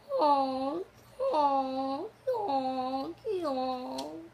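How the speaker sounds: loud honking, fading out; silly clown. Shown as a demonstration. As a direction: honk like a goose. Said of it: "Can you make a sound like a mule?".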